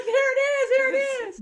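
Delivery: very high, loud relieved cry; overjoyed to get it back; relieved delight. Retold or imitated. Guards hand back the Bible, very high relieved cry.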